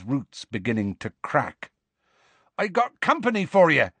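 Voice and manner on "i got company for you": higher, louder call up; Hagrid shouting. Acted. Hagrid calling up to Grawp about visitors, shouting. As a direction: announce the guests at full voice. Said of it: "Hagrid shouted".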